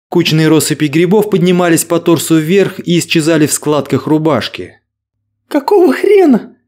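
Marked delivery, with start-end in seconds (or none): 5.5-6.6 very high panicked shriek; terrified horror victim. Acted. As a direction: scream it in sheer horror. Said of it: "сорвалась на крик Ангелина".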